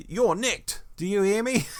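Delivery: high, mock police officer bark; hammed-up mock cop; comic copper. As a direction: play the blustering bobby making an arrest. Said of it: "which is pure comedy value".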